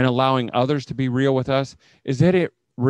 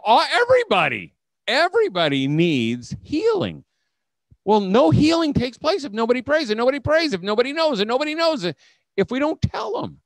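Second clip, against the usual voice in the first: high, loud rising preacher build; preaching climax; 10 semitones higher, 4 dB louder than usual. Real, not acted. Speaker urging homeschool leaders, loud high preaching. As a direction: drive it home like a sermon peak.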